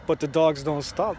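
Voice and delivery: ordinary talk, nothing marked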